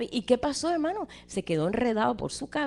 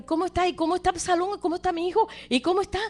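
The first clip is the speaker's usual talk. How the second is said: lightly acted worried father, pitched higher; anxious questioning; 7 semitones higher, faster than usual. David asks the messengers about his son Absalón, anxious. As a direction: press for news in a high, urgent voice.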